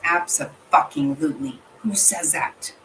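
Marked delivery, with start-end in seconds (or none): 1.8-2.7 breathless whispered disbelief. Real, not acted. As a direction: whisper it as if to yourself. Repeating the question to herself.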